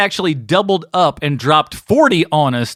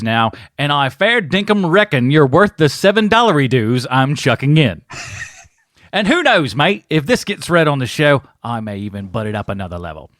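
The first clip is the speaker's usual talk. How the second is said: bad Australian accent. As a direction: play up the amateur Australian accent. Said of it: "help you with your terrible accent".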